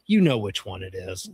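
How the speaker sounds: low-pitched, pointed deadpan; knowing deadpan. Retold or imitated. Caller hints at the grumpy receptionist, knowing deadpan. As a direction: let the obvious answer sink low.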